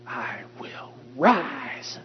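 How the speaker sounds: hushed start, then a long lifted RISE; stress on RISE. Shown as a demonstration. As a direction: softly hold back, then swell slowly on RISE. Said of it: "you put that emphasis wherever you need it".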